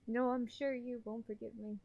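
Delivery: ordinary talk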